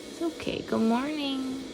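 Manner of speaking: soft, high soothing coo. Real, not acted. Comforting just-woken son.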